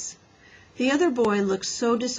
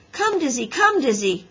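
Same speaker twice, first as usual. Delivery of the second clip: high, raised-voice dog call; boy summoning his dog; 6 semitones higher, 5 dB louder than usual. A narrator's reading. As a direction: call the dog over insistently. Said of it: "called the boy".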